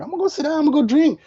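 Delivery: mock casual exit line; casual brush-off. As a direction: wave it off and head for the bar. Self-quote leaving stage.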